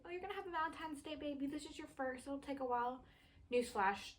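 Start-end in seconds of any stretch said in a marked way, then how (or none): 0.1-3.0 high, quick, sugary nurse imitation; sweet nurse voice. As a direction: chirp it like an overly sweet nurse. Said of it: "She was like".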